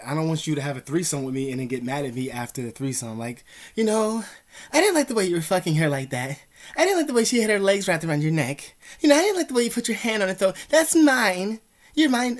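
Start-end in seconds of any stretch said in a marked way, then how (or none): 3.7-12.4 high, loud mock girlfriend voice, accusing; jealous girlfriend. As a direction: ham up the jealous partner, pitched way up and loud. Jealous girlfriend voiced, checking phone.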